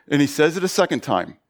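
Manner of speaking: usual speaking voice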